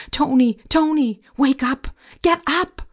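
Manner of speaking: high, urgent cry rising at the end; urgent waking shout. Acted. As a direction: shout to rouse a sleeper, pitch climbing. Frantically waking roommate in fire.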